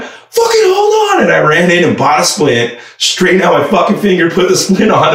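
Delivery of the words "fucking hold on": high, slow shout telling her to wait; to the yelling driver, high slow shout; shouted brush-off. Retold or imitated. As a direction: snap at the yelling lady to wait. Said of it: "she's yelling, and I'm like".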